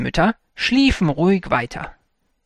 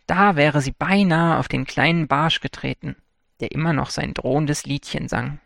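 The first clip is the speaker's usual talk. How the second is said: ordinary delivery, nothing marked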